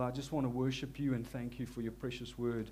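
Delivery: usual speaking voice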